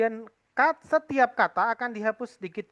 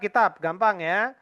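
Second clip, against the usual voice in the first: usual speaking voice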